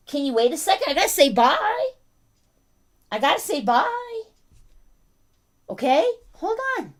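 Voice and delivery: high-pitched pleading with a dog; pet talk. Real, not acted. Owner asks barking dog to wait for goodbyes, pet talk. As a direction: beg the dog for patience in a high voice.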